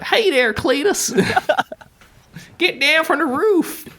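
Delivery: high, hammed-up Southern cowboy drawl; laughing through a goofy cowboy voice; cowboy American accent. Shown as a demonstration. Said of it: "[name]'s American accent ... super cowboy".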